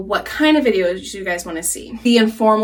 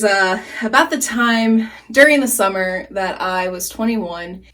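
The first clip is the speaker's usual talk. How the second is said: usual speaking voice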